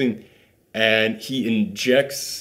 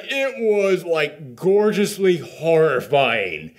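usual speaking voice

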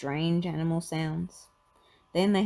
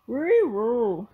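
loud dog-voiced howl of words; bay it like a talking dog; higher, louder cartoon dog; Scooby; 5 semitones higher, 7 dB louder than usual. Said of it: "said Scooby".

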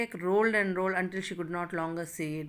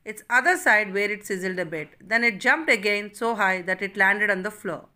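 normal talking voice

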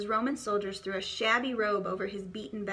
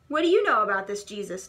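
louder, fast gruff demand; 7 dB louder, faster than usual. A narrator's reading. Old man confronts Benjamin, loud fast demand. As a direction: press the boy hard. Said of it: "demanded the old man".

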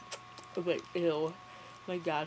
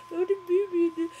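high, loud fake-tearful cry; mock wail; 11 semitones higher, 6 dB louder than usual. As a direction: wail it in hammed-up distress. Mock wailing over the baby.

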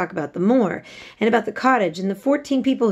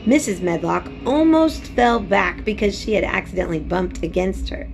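ordinary delivery, nothing marked

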